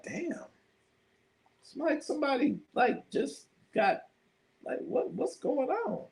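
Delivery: high, hushed disgusted disbelief; mock disgust. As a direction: react to the stinking room in shock. Retold reaction to smelly room.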